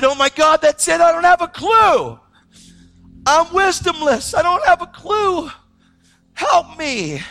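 high, loud shouted confession; reliving a frantic prayer at full force; desperate outcry. Retold or imitated. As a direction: yell to God for help, wholly at a loss. Cries out to God for help.

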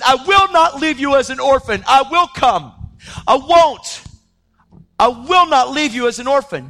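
loud, high preaching declaration; emphatic promise. Acted. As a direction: proclaim it forcefully as a solemn promise. Preaches Jesus's promise at full force.

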